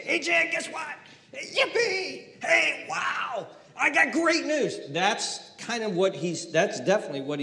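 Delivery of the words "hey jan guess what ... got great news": much higher, laughing "Yippee!" and "wow"; giddy shout of good news; excited greeting. Shown as a demonstration. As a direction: burst in bursting with good news. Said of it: "I'll say".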